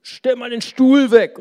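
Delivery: loud, high shouted request; calling out. Retold or imitated. Confused neighbour shouting orders to her husband, loud high call. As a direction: yell it through the curtain. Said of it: "hat sie immer gerufen".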